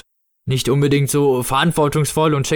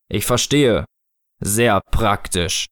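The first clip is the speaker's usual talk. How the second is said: slow, deliberate, lower voice; Snow Father figure; 4 semitones lower, much slower than usual. Acted. Snow Father considering the child's mittens, slow grave voice. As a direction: weigh each word gravely.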